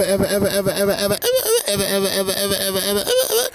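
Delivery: building chant of ever, breaking into laughter; endless ever. Real, not acted. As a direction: rattle off ever over and over, amused. Emphatic repeated never ever.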